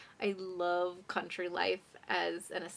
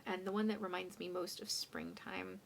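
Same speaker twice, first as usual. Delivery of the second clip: whisper; 8 dB quieter than usual. Real, not acted.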